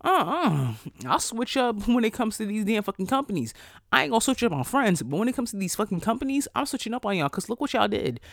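high, sassy telling-off; high sassy put-down; sassy retort. Retold or imitated. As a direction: clap back at the loyal coworker with attitude. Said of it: "I'm like".

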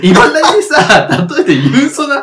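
loud, high-pitched protest through laughter; cracking up while objecting loudly; laughing protest. Real, not acted. Teasing over a dated example.

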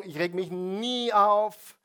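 nie punched loud and high; stress on nie. Shown as a demonstration. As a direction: hit the word "nie" hard.

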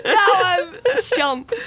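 high, slow, helpless laughing; stumped and giggling; nervous laughter. Real, not acted. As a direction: squeal it through embarrassed laughter. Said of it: "I'm, I'm nervous".

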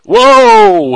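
loud, high drawn-out whoa; hammed-up shout of protest; mock alarm. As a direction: shoot up in pitch to cry foul. Mock protest at the prison joke.

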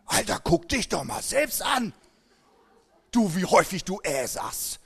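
hammed-up angry retort; attack mode. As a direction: snap back like you're hitting back at the critic. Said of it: "du gehst in den Angriffsmodus".

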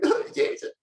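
high, squeaky mock sob; breaking into a pretend high-pitched cry; imitated weeping. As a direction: act out tearful, overwhelmed thanks. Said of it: "I'm, like, crying and weeping".

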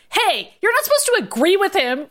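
high anime-style scolding; mock shout. A narrator's reading. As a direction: object sharply in a high voice, only half shouting. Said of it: "Ryan shouted".

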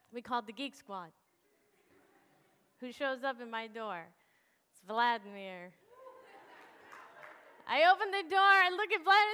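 normal talking voice

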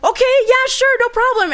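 high, loud, over-easy assent; eager agreement. Retold or imitated. Teen to mom offering pickup, mock breezy agreement. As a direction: agree readily, acted out for the story.

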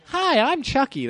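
high, slow creepy doll voice; a killer doll greeting you sweetly; Chucky voice. Retold or imitated. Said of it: "Chucky movie? You know, Child's Play".

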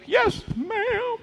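put-on high, whimpering little-boy wail; hammed-up tearful squeak; mock crying. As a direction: squeal it like a kid crying to avoid a spanking. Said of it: "You know, I'm crying".

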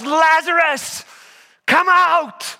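high, drawn-out call across distance; shouted command. Retold or imitated. Preacher as Jesus calling into the tomb, shouting. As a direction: shout it out, stretching the name. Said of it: "and then he shouts".

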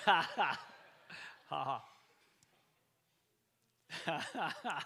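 loud staged laughter; mock laugh. Preacher laughs at his old excuse with the crowd. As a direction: laugh out loud on cue, mocking. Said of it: "Let's laugh at that as well".

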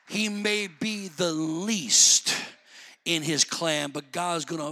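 ordinary talk

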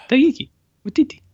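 Taiki Watiti said high in a Kiwi accent; Kiwi pronunciation. Shown as a demonstration. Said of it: "that is how you do it".

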